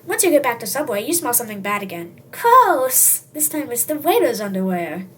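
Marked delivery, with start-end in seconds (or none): 2.3-3.3 high, loud cry of disgust. Acted. Customer smelling the waiter's underwear, loud disgust. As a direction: recoil from a stink.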